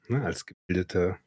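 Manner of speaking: normal talking voice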